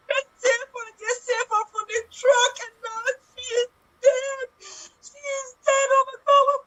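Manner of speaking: mock crying, pleading; terrified horror victim. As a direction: sob out a begging plea. Improvised horror, pleading for life.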